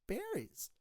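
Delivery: high, soft chirp; lady's chirped answer. Acted. Lady in the joke answers the clerk, high soft chirp.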